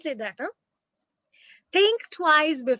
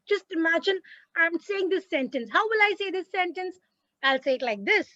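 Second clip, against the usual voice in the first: usual speaking voice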